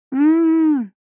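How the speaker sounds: high muffled cry; muffled scream. Acted. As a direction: scream through a hand over mouth. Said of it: "и закричала".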